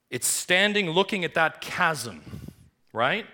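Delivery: loud, high preaching build; thundering pulpit voice; fiery preaching. Acted. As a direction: preach it with rising fire. Preaching at full force.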